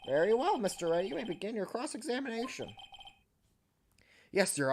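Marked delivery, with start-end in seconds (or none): 0.1-2.7 a hint of old judge voice; lightly voiced elderly judge; very old man. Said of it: "I gave him the old man voice".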